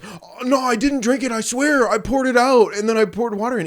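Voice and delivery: put-on high, frantic kid denial; squeaky scared little brother; panicked pleading. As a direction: beg dad to believe you. Brother panicking before dad.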